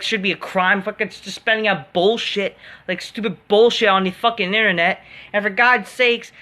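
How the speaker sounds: ordinary talk, nothing marked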